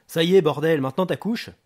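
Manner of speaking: loud, high-pitched impatient demand; fed-up gangster losing patience; exasperated. Acted. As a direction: snap at the caller to get on with it. Booker loses patience on the phone.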